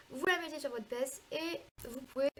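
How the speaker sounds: normal talking voice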